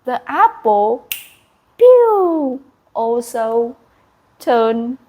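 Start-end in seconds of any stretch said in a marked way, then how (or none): 1.8-2.6 bright high pew sound effect; a quick high zap like a spell hitting; a sharp, loud sparkle noise; magic zap sound. Acted.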